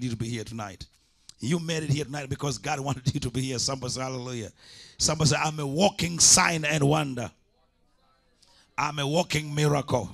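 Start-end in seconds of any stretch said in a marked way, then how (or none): 5.0-10.1 loud, rising call to the congregation; full-force preaching. Acted. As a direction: preach it out, full voice, stirring the crowd.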